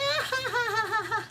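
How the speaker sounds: high, helpless peal of laughter; reenacted laughing fit. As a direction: imitate yourself cracking up. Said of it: "I was just like".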